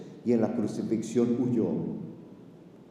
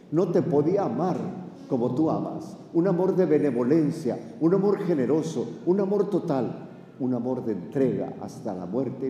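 preaching fervour; 4 semitones higher than usual. Acted. Preacher building on love of Jesus.

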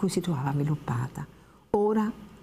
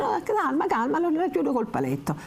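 very high, fussy calming; soothing old woman; 11 semitones higher than usual. Acted. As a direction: hold the girl back, voice up high. Old woman stops Lucia fleeing. (la vecchia la calma con voce acutissima)

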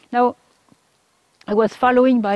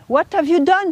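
higher-pitched, imitated confrontation; blunt reproach; 7 semitones higher than usual. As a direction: confront with no polite preamble. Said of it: "is not a matter of politeness".

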